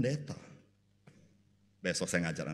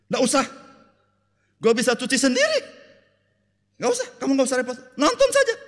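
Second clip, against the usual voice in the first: loud, high, fast sarcastic wife; husband's offer rebuffed by a shrill, loud mocking wife; nagging wife; 9 dB louder than usual. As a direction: snap it out like an annoyed wife.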